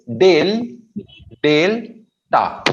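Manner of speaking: "Del", pause, "Delta" broken before the "टा"; teacher separating "Del" from "टा"; two syllables. Shown as a demonstration. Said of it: "We have two syllables". ("Del" और "टा" अलग-अलग)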